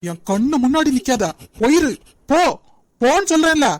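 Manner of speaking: husband shouting at his wife to get out; furious order. Acted. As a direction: throw her out of your sight. Jeevan drives Janani away.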